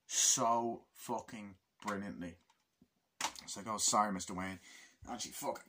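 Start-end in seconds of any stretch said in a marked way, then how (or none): none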